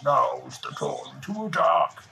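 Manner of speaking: slow menacing monster voice; villain virus drawl, slow; scary monster. Acted. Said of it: "scary monster virus who says".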